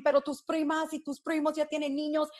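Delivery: high, pressing relatives' nag in Spanish; a touch of a pushy tía; imitating nagging family. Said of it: "I'm tired of the family saying".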